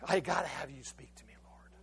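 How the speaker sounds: breathless whisper of a plea; hushed whispered plea, no voice; whispered prayer. Real, not acted. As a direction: whisper it to God alone. Intimate plea to God.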